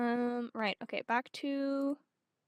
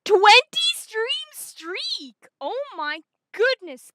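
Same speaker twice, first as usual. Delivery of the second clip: high, loud, drawn-out astonishment; amazed excitement; 8 semitones higher, 13 dB louder, slower than usual. Real, not acted. Streamer marveling at a viewer's attendance streak, amazed excitement. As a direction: exclaim it loud, pitched right up.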